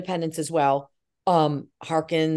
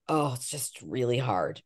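soft, low, slow complaint; downcast; 6 semitones lower, 7 dB quieter, slower than usual. Retold or imitated. Struggling daughter confiding to her mother by phone, downcast. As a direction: sigh it out, dejected.

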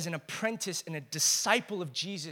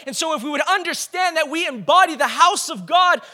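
louder, higher, ringing proclamation; full-force preaching; 7 semitones higher, 11 dB louder than usual. Real, not acted. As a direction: proclaim it to the whole church.

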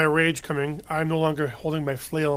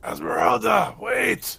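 calling out; 6 semitones higher, slower than usual. Acted. Chasing and calling after her.

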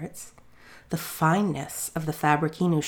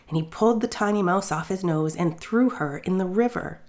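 ordinary delivery, nothing marked

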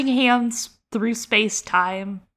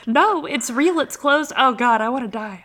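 high, louder mock anguish; a hint of desperate sobbing; imitating desperate Lyra; 6 semitones higher, 4 dB louder than usual. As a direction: voice Lyra begging at the closed window. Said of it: "she's like".

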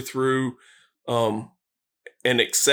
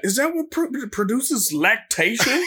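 put-on high childish voice; 9 semitones higher than usual. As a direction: ask it like a wide-eyed child. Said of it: "And I'm like".

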